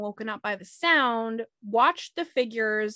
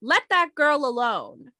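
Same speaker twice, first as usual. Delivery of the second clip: restrained shout, higher and drawn out; retold shout; 4 semitones higher, 4 dB louder than usual. Narrator retelling a man's shout from his window, mild shout. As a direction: call it out slowly, short of a real yell. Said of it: "he opened up his window and he shouted".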